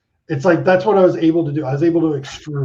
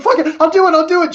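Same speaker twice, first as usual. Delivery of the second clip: much higher and louder burst of caving in; impulsive giving in; 13 semitones higher, 6 dB louder, faster than usual. Retold or imitated. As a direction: blurt it out, throwing caution away. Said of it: "I'm like".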